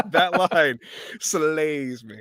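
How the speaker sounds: ordinary delivery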